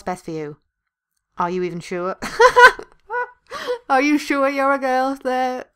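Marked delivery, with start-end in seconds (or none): 2.2-5.7 slow, high and loud through laughter; laughing. Real, not acted. Doubting a friend is really a girl, laughing. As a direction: crack up while asking. Cracks up while reading the line.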